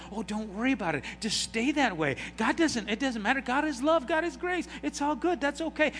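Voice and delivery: mock licentious friend excusing sin; permissive churchgoer. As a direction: brush off the sin lightly. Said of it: "and you go".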